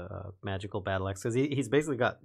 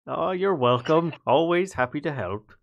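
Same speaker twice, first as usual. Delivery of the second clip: louder, higher, playful reply; hammed-up obliging; 6 semitones higher, 7 dB louder than usual. Ulfgar answering thanks for cleaning teeth, hammed-up obliging. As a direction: play up the eager helper.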